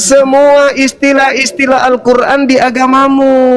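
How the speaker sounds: high, slow, spelled-out demand; slow insistent lecturing; slow emphatic demand. Real, not acted. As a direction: lay down the rule slowly. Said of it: "laying down demands slowly".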